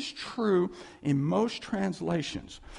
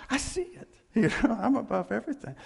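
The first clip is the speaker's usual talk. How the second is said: laughing; 5 semitones higher, faster than usual. Acted. Laughing as God.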